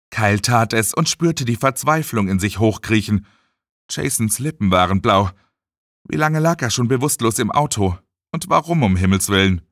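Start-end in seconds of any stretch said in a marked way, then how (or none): none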